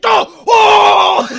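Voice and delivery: high, full-volume acted screaming; losing it. Larry hearing about the baby, screaming in hammed-up meltdown. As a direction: scream the refusal as if losing it completely. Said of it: "He just loses his shit".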